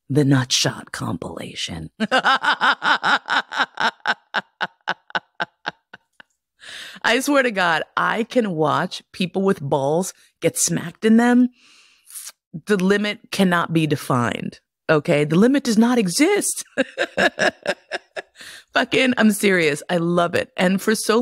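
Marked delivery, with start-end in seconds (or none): 1.9-4.7 cracking up at the nutshot line; real laugh. Real, not acted. Laughs at her nutshot joke. 16.7-18.2 high squealing laugh; giggling at her own joke; real laugh. Real, not acted.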